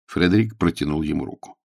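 ordinary delivery, nothing marked

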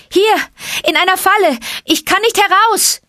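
put-on high, loud boyish cry; calling out. A narrator's reading. Trapped boy calls to his rescuers, high shout. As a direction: call urgently from inside a trap. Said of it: "rief Mowgli".

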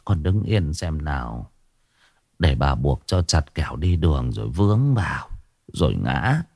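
a lightly voiced old woman, quiet and low; hoarse grandmother. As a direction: speak gently as the old grandma tying the sash. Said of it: "Giọng bà khan khan".

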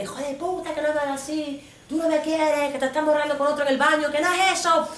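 high, angry tirade; furious breakup rant. Retold or imitated. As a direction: rant at him. She curses boyfriend in breakup.

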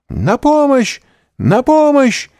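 очень высокий громкий крик о помощи; зови на помощь изо всех сил. A narrator's reading. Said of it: "закричала мадмуазель д'Армильи". (крик о помощи)

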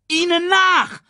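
very high, loud screech; shrieking high battle cry; screeched command. A narrator's reading. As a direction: screech the order to give chase. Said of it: "kreischte Shenzi".

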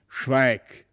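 stern low command, understated anger; angry. A narrator's reading. Father silencing his son, sternly angry. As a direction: cut the boy off sternly. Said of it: "rief der Herr von Brakel ganz erzürnt".

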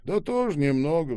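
ordinary delivery

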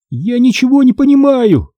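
high-pitched bewildered cry; desperate shout. A narrator's reading. Boy to the unseen ghost, desperate high cry. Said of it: "кричал я".

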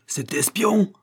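high, indignant exclamation; outraged roar. A narrator's reading. Father enraged at the spy, high indignant roar. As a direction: throw it back in outrage. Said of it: "rugit William Bolton".

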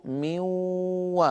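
"من و" as a long-held nasal waw, no n; noon into waw. Shown as a demonstration. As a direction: stretch the humming waw in "من و". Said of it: "فصار النطق".

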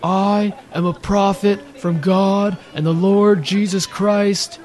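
high, loud, slow prophetic shouting; imitated street preacher yell. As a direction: bellow it like a street prophet claiming God's authority. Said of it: "he yells".

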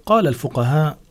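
ordinary talk, nothing marked

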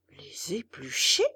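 put-on old man can't believe his ears; astonished. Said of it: "répondit Geppetto éberlué".

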